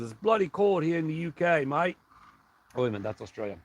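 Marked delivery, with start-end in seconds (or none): 0.0-2.0 a light put-on British accent, read from chat; lightly voiced Aussie-style chat quote; mock British accent. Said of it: "Julie says".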